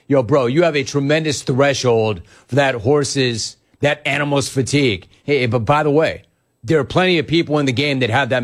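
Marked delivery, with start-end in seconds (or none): none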